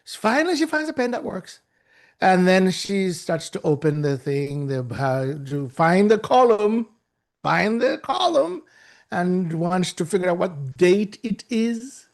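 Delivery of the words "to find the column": ordinary talk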